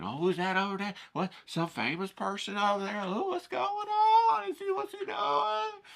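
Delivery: high, breathless nosy chatter rising to shrieks; gossiping women. Retold or imitated. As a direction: gawk and gossip. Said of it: "Four or five other women".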